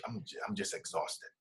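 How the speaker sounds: very soft, low, nearly whispered exhaustion; retold exhausted wife. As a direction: imitate a spent wife, barely voiced. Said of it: "crying on the floor".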